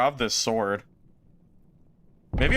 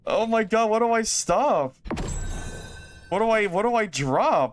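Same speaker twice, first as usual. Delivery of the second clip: shrieking jackpot winner; 8 semitones higher than usual. Real, not acted. Frantic over inventory choices.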